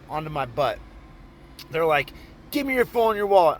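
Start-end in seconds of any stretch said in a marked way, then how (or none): 2.5-3.6 higher, rapid-fire mock demand; acted robber's demand. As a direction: imitate a mugger rattling off orders.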